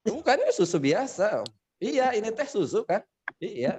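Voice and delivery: high-pitched comic back and forth; confused two-way exchange. Acted. Sundanese and Javanese speakers misunderstanding each other, high and comic. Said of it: "Saya nanya".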